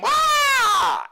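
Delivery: very high, loud "Ah!" scream; excited concert scream. Shown as a demonstration. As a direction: scream it out like a thrilled fan.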